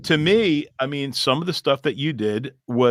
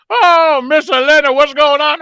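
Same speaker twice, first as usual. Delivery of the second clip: squealing high mock voice, much louder; a loud, high comic falsetto; high put-on voice; 12 semitones higher, 8 dB louder than usual. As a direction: shriek it up high like a cartoon. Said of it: "all of a sudden I go".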